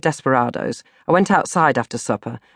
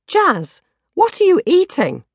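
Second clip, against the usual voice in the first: Jazz's voice; 14 semitones higher than usual. A narrator's reading. Narrator voicing friend Jazz.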